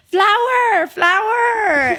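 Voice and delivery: high-pitched child's call, drawn from a retold scene; mock calling out. Little girl calls her teacher names, mock calling out. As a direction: imitate a little kid calling out.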